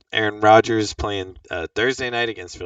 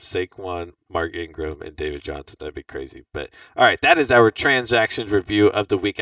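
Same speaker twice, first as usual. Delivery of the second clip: ordinary talk, nothing marked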